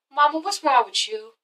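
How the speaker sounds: lightly voiced daughter; a touch of a kid's bluntness; blunt child voice. Noelle questioning her mom, lightly voiced blunt. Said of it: "Noelle's just like".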